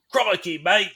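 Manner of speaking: high, hammed-up Australian accent; mock Australian exclamation. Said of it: "like, the stereotypical Australian stuff".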